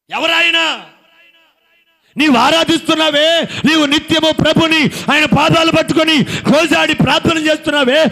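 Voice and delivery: loud, high preaching to the congregation; full-force preaching. Acted. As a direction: preach it out, rousing the crowd.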